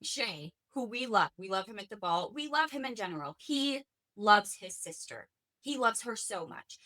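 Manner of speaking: ordinary delivery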